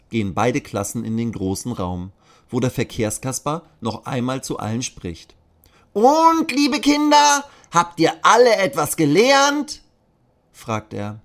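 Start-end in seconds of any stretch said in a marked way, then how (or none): 5.9-9.8 very high, loud puppet voice; a squeaky Punch puppet addressing kids; Kasper puppet voice. Acted. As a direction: traffic puppet quizzing the children. Traffic puppet addresses children.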